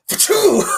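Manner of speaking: high, loud mock "ba-choom"; imitated sound effect. Co-host mimics Robert Carradine's gadget move, imitated sound effect. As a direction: whoosh it out like a cartoon effect. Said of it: "the sound effect of the ba-choom".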